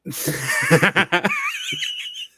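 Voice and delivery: a sudden high burst of laughter; loud laugh. Real, not acted. Guest laughing at being asked to rap his answer, big laugh. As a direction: break into a big laugh at the request. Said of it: "How about iambic pentameter?".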